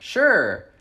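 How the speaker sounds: high, loud, put-on sure; feigned agreement. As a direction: agree just to get rid of him. Feigned agreement to take his number.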